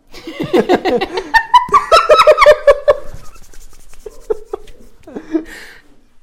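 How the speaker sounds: loud high burst of laughter; a real, long peal of laughter; big laugh. Real, not acted. Laughing hard at his friend's plan to name a son Edgar tres.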